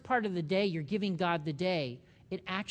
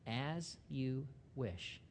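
very soft, very slow, lowered; tender whisper of devotion; 6 semitones lower, 10 dB quieter, much slower than usual. Pretend. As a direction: breathe it like a loving vow. Wesley quote, tender.

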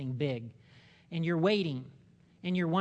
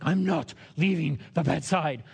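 a louder, insistent mother refusing to budge; stubborn mother; 6 dB louder than usual. Retold or imitated. Pastor voicing a mother refusing to leave her sick daughter, louder and insistent. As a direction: plant your feet, louder, won't leave her child. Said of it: "where she's like".

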